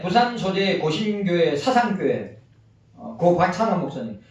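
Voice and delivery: usual speaking voice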